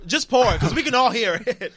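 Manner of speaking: high, loud teasing call-out. Real, not acted. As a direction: call them out loudly, amused. Co-host audibly drinking on mic.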